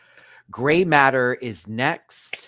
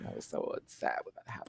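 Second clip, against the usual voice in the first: usual speaking voice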